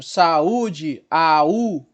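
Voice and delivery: "saúde" with A and a long U apart; hiatus demo. Shown as a demonstration. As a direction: stretch sa-Ú-de, stressing U in "saúde". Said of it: "Então eu tenho duas vogais". ("saúde" com duas vogais fortes separadas)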